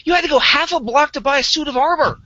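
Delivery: high-pitched mock scolding; scolding partner. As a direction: chew him out like a fed-up partner. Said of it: "she is pissed as hell".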